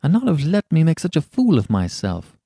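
understated wounded grumble; sulky. A narrator's reading. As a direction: reproach a friend, a touch sulky. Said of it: "said Hughie sulkily".

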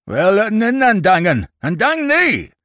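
high, loud farmer's bellow; surly shout. A narrator's reading. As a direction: call out roughly in irritation. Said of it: "a surly male voice called out".

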